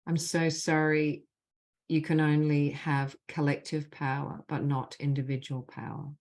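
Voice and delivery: measured, deliberate leading of a phrase; guided healing phrases. Acted. Facilitator gives the group apology phrases to repeat, measured and deliberate. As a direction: lead the group line by line, leaving space after each.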